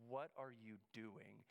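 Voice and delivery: low, slow, drawn-out disbelief; baffled look voiced. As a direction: imitate a slow, incredulous stare in words. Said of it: "gives me that look like".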